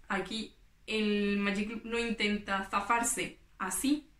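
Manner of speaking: ordinary delivery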